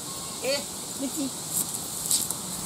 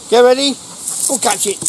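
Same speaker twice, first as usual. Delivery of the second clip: excited fetch call; 8 semitones higher than usual. Real, not acted. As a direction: wind the dog up before the throw. Throwing the ball.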